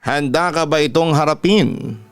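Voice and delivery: ordinary delivery, nothing marked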